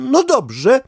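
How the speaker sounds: very high, startled duck voice; a duck jumping up in disbelief; indignant duck. Acted. Duck to dog, startled disbelief in a very high voice.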